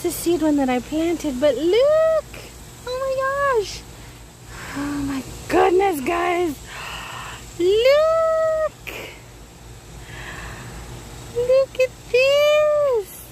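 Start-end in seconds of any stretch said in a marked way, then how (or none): 1.4-3.8 excited. Real, not acted. Excited harvest reveal. 4.8-13.2 excited. Real, not acted. Thrilled at tiny potatoes.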